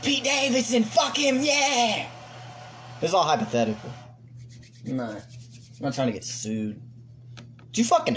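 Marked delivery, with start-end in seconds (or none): 0.0-2.1 high, hammed-up crowd cheer. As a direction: play the rowdy crowd yelling its approval. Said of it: "The crowd goes".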